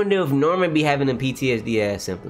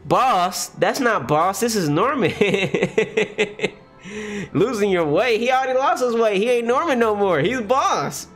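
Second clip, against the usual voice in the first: excited high rant; 9 semitones higher than usual. Real, not acted. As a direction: pitch way up, insisting hard. Excited rant about Norman.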